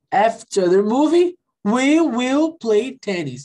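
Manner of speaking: slow, clear dialogue line; teacher modelling a sentence slowly; slow model. Shown as a demonstration. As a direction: read it slowly for learners.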